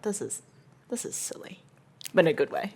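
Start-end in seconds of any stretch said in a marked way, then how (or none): 0.0-1.6 soft whispered aside. Retold or imitated. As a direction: breathe it out softly. Retold viewer reaction.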